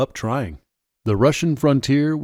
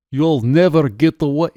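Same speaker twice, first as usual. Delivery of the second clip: put-on higher, louder Russian-accented warning; 4 semitones higher, 6 dB louder than usual. A narrator's reading. Officer at gunpoint starts to warn, in a Russian accent. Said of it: "he asked in a heavy Russian accent".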